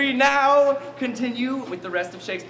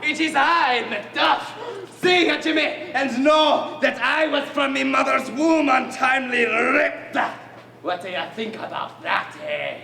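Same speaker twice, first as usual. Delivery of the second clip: hammy Scottish Macduff; 2 semitones higher than usual. Acted. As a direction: crow at Macbeth in broad Scots. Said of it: "in perfect Scottish accent".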